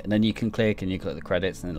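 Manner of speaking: ordinary delivery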